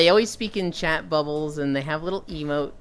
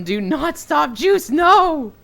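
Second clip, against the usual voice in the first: higher and louder urgent yell; mock alarm shout; 10 semitones higher, 7 dB louder, much slower than usual. Real, not acted. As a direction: yell the warning at Juice.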